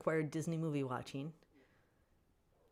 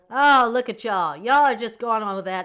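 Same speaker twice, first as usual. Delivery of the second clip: teasing call-out; 5 semitones higher, 14 dB louder than usual. Real, not acted. Laughing call-out to chat.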